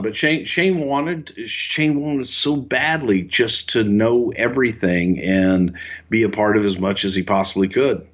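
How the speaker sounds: usual speaking voice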